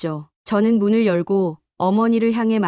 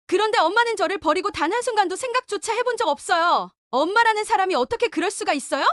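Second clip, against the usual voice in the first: much higher, biting and scornful; bitter scorn; 9 semitones higher than usual. Acted. Daughter rejecting her mother, higher and scornful. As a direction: sneer at her greed.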